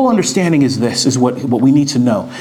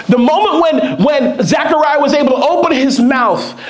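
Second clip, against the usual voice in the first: fervent preaching; 12 semitones higher than usual. Real, not acted. Preacher to congregation, shouting out the rejoicing. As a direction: preach it with rising joy.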